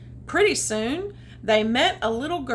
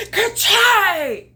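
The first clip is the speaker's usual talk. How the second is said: loud, high hammed-up sneeze; cartoon ka-chai sneeze; big sneeze; 9 semitones higher, 10 dB louder than usual. A narrator's reading. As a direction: let the sneeze explode. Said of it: "and it came".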